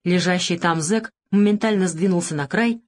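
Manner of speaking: ordinary delivery, nothing marked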